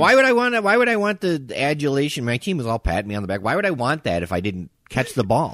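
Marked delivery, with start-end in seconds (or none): 0.0-2.2 high, loud incredulous question; indignant protest. Real, not acted. Player defending his honesty to co-host, loud indignant protest. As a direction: start at a near-yell, pitched way up.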